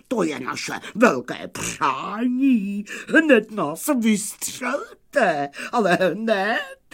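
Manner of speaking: high, drawn-out eager pleading; eager wolves. A narrator's reading. Wolves demanding to be fired right away, eager high pleading. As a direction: plead excitedly in a high put-on voice. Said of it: "vykřikli nadšeně vlci".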